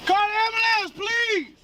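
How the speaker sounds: high-pitched frantic cries for help; screaming. Real, not acted. Detained man calling for an ambulance, screaming. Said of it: "Why are you screaming?".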